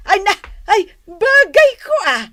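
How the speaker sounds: high and loud with glee; thrilled exclamation over a gift; squealing with delight; delighted cry. Acted.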